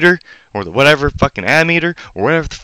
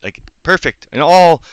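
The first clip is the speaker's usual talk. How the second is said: ordinary delivery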